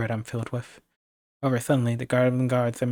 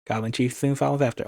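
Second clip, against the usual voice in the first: usual speaking voice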